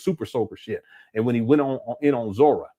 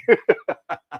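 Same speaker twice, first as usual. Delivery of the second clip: high, bursting chuckle; amused laugh; 4 semitones higher, 3 dB louder than usual. Real, not acted. Laughing at Umar blaming contractors, a high chuckle. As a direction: let a real laugh break out.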